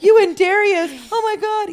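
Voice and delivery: high, loud, frantic mimicking of worried friends; mock panic. Friends panicking at [name] about losing her home, mock frantic. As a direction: squeal it like everyone's freaking out for you. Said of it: "I had people around me like".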